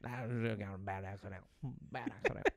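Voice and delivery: low, fast Cajun mumble; Cajun impression. Cajun guide brushes off the lost leg, mumbled Cajun accent. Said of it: "They really do talk like that".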